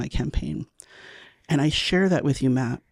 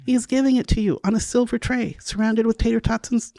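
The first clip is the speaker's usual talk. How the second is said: scolding herself; 6 semitones higher than usual. Retold or imitated. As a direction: berate yourself, pitch climbing with disbelief. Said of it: "I go".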